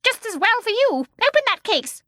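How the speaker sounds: put-on high, loud, cross old woman; fierce command. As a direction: order her about crossly. Fierce mother orders daughter.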